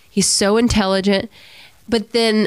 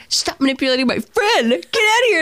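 mock scolding; 9 semitones higher than usual.

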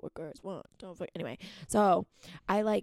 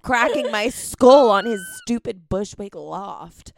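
loud rant; 3 semitones higher, 12 dB louder, slower than usual. Retold or imitated. Ranting about cracking her skull.

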